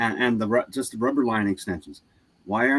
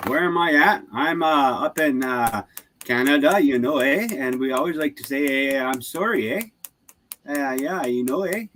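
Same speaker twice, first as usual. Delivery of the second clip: hammed-up, lilting Canadian accent; 3 semitones higher than usual. Canadian telling where he is from, playful Canadian accent. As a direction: play up the Canadian "eh". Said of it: "that accent is, you don't hear it all the time".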